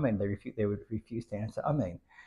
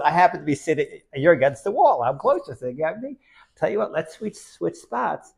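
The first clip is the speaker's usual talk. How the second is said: eager offer; 5 semitones higher, 8 dB louder than usual. Acted. Zusha proposes switching spots.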